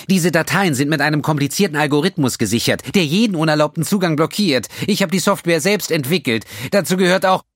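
high and loud, climbing as he goes. A narrator's reading. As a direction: lecture him, getting shrill. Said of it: "Seine Stimme wurde lauter und schriller".

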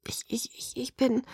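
breathless whispered stammer. A narrator's reading. Bella stammers her guilt to Edward, whispering. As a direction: falter in a hushed whisper.

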